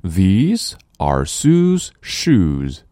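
very slow, careful model sentence; very slow model reading. Shown as a demonstration. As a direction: spell out each word slowly for learners. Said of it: "我们再来大声朗读". (极慢的示范朗读)

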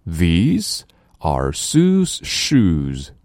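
very slow, careful model sentence; very slow model reading. Shown as a demonstration. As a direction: spell out each word slowly for learners. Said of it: "我们再来大声朗读". (极慢的示范朗读)

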